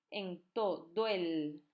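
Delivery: very slow, "todo el" drawn out; slow syllable reading. Shown as a demonstration. Teacher pointing out another synalepha to the class. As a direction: linger on "En todo el" to show the synalepha. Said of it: "aquí hay otra sinalefa".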